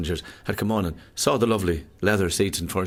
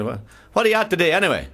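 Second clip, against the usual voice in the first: cheery chat; 8 semitones higher, 5 dB louder than usual. Acted. Neighbour keeps chatting.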